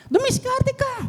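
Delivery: emphatic urging. Acted. Pastor urging the congregation to hustle, exclaimed high. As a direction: push them to get resourceful.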